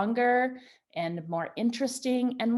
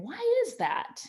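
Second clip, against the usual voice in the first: wondering question; 11 semitones higher than usual. Retold or imitated.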